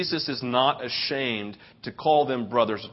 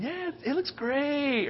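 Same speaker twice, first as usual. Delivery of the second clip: high, soft, hesitant praise; drawn-out, unconvinced compliment; half-hearted agreement; 10 semitones higher, 7 dB quieter, slower than usual. Retold or imitated. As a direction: imitate a husband feebly playing along. Imitates man's lukewarm agreement.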